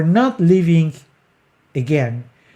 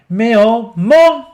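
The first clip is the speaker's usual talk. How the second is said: "mon" higher and louder than "oh"; increasing volume; 6 semitones higher, 6 dB louder than usual. Shown as a demonstration. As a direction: push up onto "mon". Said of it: "she's still increasing the volume".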